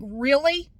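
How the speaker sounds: loud, high, rising question; incredulous. Retold or imitated. Customer to staff ignoring her in Spanish, incredulous. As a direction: challenge them with a disbelieving upturn.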